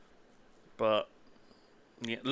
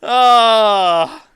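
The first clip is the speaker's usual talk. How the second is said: high, loud laughing words; 10 semitones higher, 12 dB louder, slower than usual. Real, not acted. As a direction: talk through the laughter. Laughing at photo.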